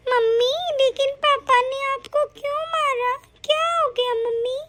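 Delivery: put-on high, small child's voice, worried; a young daughter anxiously asking her mom; little girl voice. Daughter asks why papa hit.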